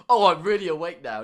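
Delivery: loud insistent mock protest. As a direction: blurt it out loud as if caught napping. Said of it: "you just went".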